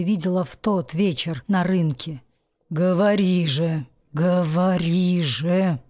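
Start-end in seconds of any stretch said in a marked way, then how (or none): none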